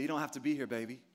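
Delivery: soft, low coaxing to a lost lamb; tender coaxing. Pretend. God coaxing his little sheep home, tender and soft. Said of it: "They say".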